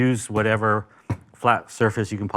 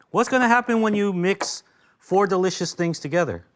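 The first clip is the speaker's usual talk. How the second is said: out of breath; 6 semitones higher than usual. Real, not acted. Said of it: "I don't know why I'm out of breath".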